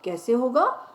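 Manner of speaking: ordinary talk, nothing marked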